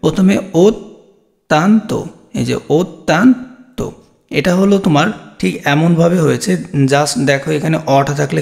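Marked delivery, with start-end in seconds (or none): 0.5-2.0 অত্যন্ত very slowly as "ot... tan... to"; syllable by syllable. Shown as a demonstration. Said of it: "কীভাবে উচ্চারণ করতে হবে?". 2.7-3.9 অত্যন্ত stretched, "ot... tan... to"; syllable by syllable. Shown as a demonstration. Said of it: "কীভাবে উচ্চারণ করতে হবে?".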